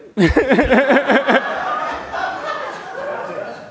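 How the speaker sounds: long breathy real laugh, falling away. Real, not acted. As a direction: let the laugh spill out and fade. Laughs at own deadpan reply.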